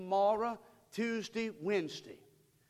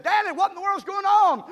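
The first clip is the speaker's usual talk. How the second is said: high, loud alarmed cry; startled wife; 12 semitones higher, 10 dB louder than usual. Retold or imitated. Wife running outside calling to Danny, alarmed. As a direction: shout out in alarm. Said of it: "she said".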